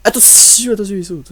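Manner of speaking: high, excited alarm call; urgent warning. Real, not acted. Player warning as the monster jumps, in urgent high alarm. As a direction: call out the danger, pitched high.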